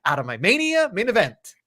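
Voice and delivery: exasperated fan. Retold or imitated. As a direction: beg him to stop, half laughing. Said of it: "And you're like, your asshole's tightening up".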